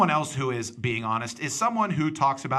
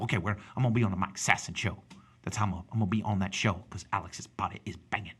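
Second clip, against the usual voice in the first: fast, low Texas twang; Texas accent; 5 semitones lower, 5 dB quieter, faster than usual. Shown as a demonstration. Showing how Texas people talk, fast Texas accent. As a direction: run the words together, Midland Texas style. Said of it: "that's how Texas people talk. Everything's fast".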